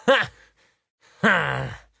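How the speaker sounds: a hollow echo of nymph voices; the other nymphs echo her. Acted. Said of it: "le altre otto ninfe riecheggiarono". (le ninfe fanno eco in coro)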